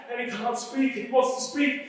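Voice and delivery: usual speaking voice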